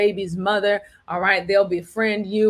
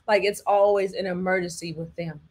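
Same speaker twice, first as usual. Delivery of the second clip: ordinary delivery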